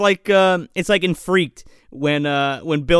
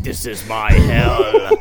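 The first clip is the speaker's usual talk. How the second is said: low, slow, loud mock-doom voice; Pinhead; 8 semitones lower, 6 dB louder, slower than usual. Unenthused demon lamenting his lot, hammed-up doom. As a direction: groan it grandly like a fed-up demon.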